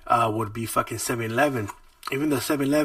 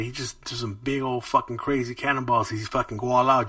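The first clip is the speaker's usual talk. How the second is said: normal talking voice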